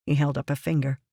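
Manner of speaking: ordinary delivery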